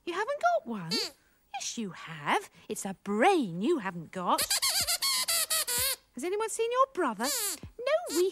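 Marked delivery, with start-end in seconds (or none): none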